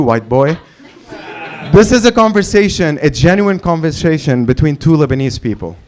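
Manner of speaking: usual speaking voice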